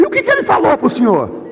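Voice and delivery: high, agitated question; acted high-pitched, worked-up demand; anxious urgency. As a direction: press desperately for the answer. Anxious question in retold call.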